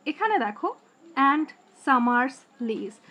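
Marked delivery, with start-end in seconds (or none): 1.3-3.0 very slow, each syllable set apart; slow scansion reading. Shown as a demonstration. Teacher spelling out the metre for students, very slow. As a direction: read it out syllable by syllable. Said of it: "দেখো সাম Mars".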